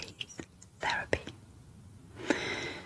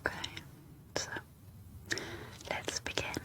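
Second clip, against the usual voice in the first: asmr whisper. Real, not acted. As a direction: whisper every word close and gentle. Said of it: "in an A S M R whisper".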